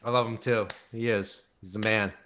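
dry sarcastic praise. Real, not acted. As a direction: say it deadpan, not meaning it. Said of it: "That sounded sarcastic".

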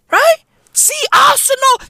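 very high, emphatic repeat; high-pitched insistence. Real, not acted. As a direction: voice shoots way up.

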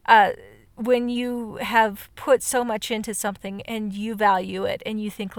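normal talking voice

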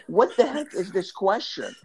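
high-pitched, incredulous retort; retold exasperated disbelief. Kid reacting to the neighbor's question, in high mock disbelief. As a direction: balk at an absurd question, replayed for laughs.

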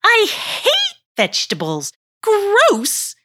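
high, loud disgusted yell; disgusted shout. Acted. Girl disgusted by vegetables.

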